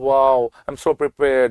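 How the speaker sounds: slow, drawn-out smug student voice; lightly voiced smug student; mock overconfident student. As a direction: play the cocky student who thinks he's ready. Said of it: "You'll say".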